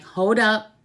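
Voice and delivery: mock-stern mother calling out; sharp call-out. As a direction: stop her in her tracks. Mother stopping daughter sharply.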